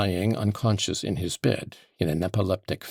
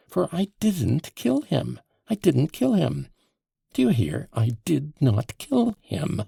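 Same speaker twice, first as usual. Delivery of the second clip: higher, strained insistence, close to choking; almost choking; 8 semitones higher, 4 dB louder than usual. A narrator's reading. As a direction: force it out through a tight throat. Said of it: "He was almost choking".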